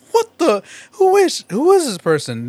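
high, loud outraged yell, imitating the actor; shocked yell. Actor in commercial meets his replacement, shocked high yell. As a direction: shout it out in mock disbelief. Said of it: "And he's like".

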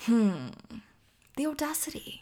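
quiet, haughty mutter of disdain; snooty mock disdain. Fan imitating a rival skater eyeing the crowd's favourite, quiet and snooty. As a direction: drop to a soft, sniffy judgement. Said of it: "just going".

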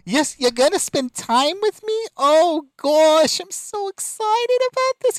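high, gushing wife voice. Retold or imitated. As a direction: squeal it like an overeager wife. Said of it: "she's all".

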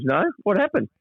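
high, puzzled voice imitating a friend; confused Longy. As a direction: play him as clueless and innocent. Longy doesn't remember the call.

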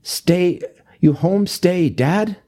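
high-pitched mock German accent, halting question; thick European accent. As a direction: imitate an older lady's German-tinged English. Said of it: "like, really thick European accent".

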